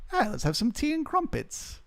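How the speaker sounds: a hint of a British accent, teasing; mock British accent. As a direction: lilt it like a cartoon Englishman. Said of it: "the British accent?".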